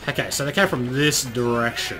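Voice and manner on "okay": normal talking voice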